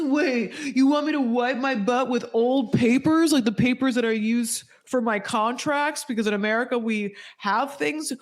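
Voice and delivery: high, squeaky mock outrage; mock Rick outrage. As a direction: shriek it like a spoiled tourist. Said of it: "he's just like".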